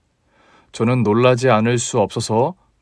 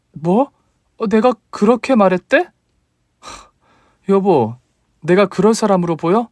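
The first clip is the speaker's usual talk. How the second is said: put-on higher, indignant young wife voice; 6 semitones higher than usual. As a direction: defend yourself to your husband, higher. Wife arguing with husband.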